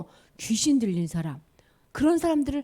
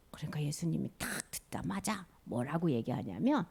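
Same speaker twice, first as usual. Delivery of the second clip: 속삭이듯 말하기; hushed storyteller whisper; 7 semitones lower, 12 dB quieter than usual. A narrator's reading. 이야기 긴장감을 속삭임으로. (숨죽여 속삭이기)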